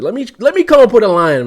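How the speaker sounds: high, loud, eager outburst; excited shout. Real, not acted. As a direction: yell it like a hype man. Joking he wants easy money.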